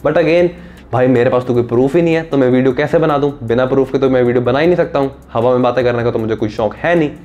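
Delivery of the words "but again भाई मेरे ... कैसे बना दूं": ordinary delivery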